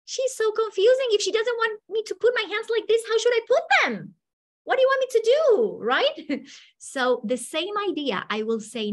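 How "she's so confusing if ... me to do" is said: much higher, louder, faster frustrated student; student complaining about the teacher; confused student. Retold or imitated. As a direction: whine like a lost, confused pupil. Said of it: "Probably what happened was you felt".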